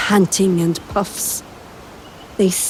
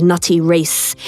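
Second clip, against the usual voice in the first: usual speaking voice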